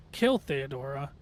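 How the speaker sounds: ordinary talk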